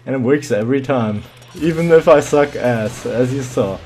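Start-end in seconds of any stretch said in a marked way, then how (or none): none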